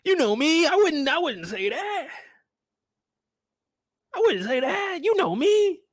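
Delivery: high, whiny denial; squeaky defensive pleading; mock pleading man. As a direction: beg innocently in a high voice. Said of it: "He talking about".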